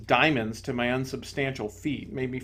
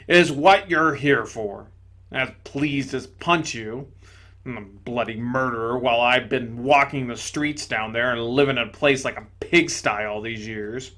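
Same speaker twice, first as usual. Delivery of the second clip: loud, gruff ghost exclaiming; indignant big ghost; 4 dB louder than usual. Acted. Big ghost to the bright spirit, loud indignation. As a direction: blurt it out loudly, amazed and offended.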